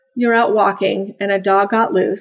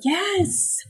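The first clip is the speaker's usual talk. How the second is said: high-pitched, softer praise word; upbeat encouragement; 8 semitones higher, 6 dB quieter than usual. Acted. Trainer as puppy approaches something new, upbeat encouragement. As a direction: cheer the pup on with a high lift.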